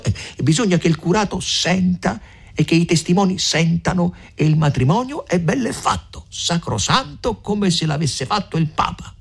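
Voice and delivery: lightly voiced older woman pressing her plan; a hint of a shrewd mother urging them on; Agnese, eager. A narrator's reading. Mother urging the young couple, lightly voiced.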